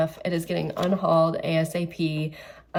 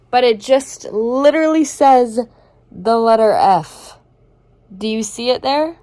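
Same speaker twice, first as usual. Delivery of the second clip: incredulous outrage; 4 semitones higher, 10 dB louder than usual. Real, not acted. Outraged at the book's text.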